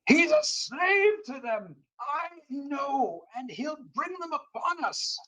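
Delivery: high-pitched livid wail, drawn out; livid raving. Acted. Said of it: "He's so livid".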